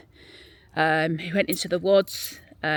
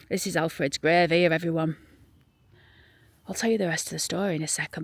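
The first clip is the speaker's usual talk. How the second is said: ordinary talk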